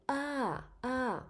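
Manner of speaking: "ah" starts high then falls down; falling tone ah. Shown as a demonstration. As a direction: lift "ah" high and let it drop. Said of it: "The voice goes up and then back down".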